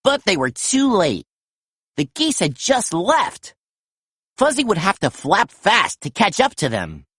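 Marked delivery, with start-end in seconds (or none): none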